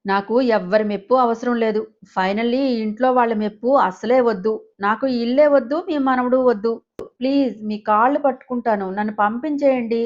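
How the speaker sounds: plain reading voice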